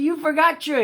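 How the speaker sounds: loud call, cut off; calling after. Acted. Store man calls after Walker, loud. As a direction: shout after him then break off.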